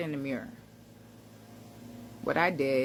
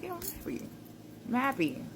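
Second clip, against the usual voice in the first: high, soft, amazed realization; stunned joy at the mirror; surprised delight; 8 semitones higher, 5 dB quieter than usual. Retold or imitated. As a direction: let the discovery break through in a high voice.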